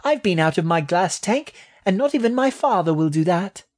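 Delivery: high little rat voice; high-pitched young creature; boastful baby rat. A narrator's reading. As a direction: boast as a tiny, proud rat.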